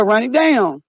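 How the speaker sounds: normal talking voice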